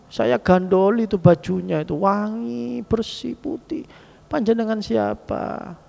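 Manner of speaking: high, slow and hushed; awed young man. Acted. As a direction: son holding the stranger's robe, awed and pleading. Son clings to stranger's robe.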